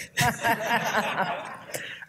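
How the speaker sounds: whispered high laugh; airy amused laugh; breathy laugh. Real, not acted. Laughs after mum quote.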